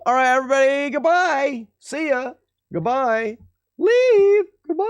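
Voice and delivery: high goofy sign-off; sing-song silly farewell; silly goodbye voice. Acted.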